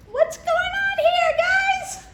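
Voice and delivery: a high, slow, bewildered shopkeeper voice; surprised peddler. Acted. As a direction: play the peddler looking up, baffled. Voices the peddler seeing monkeys.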